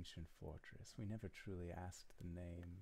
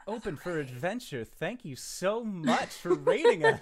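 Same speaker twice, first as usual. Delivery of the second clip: bright loud hammy welcome; loud cheerful shout; 12 semitones higher, 18 dB louder than usual. Acted.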